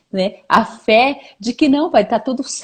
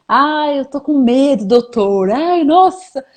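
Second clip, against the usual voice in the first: choramingo fingido de paciente com medo, em tom mais agudo; medo encenado; 4 semitones higher than usual. Acted. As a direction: imite um paciente alarmado no dentista. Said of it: "tá com medo de passar no dentista". (medo de dentista, exagerado de brincadeira)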